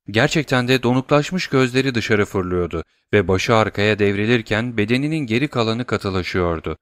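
usual speaking voice

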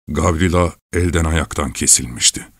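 usual speaking voice

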